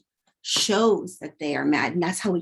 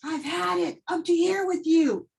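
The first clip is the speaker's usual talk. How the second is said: mock yell; 9 semitones higher than usual. Shown as a demonstration. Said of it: "'cause they're yelling".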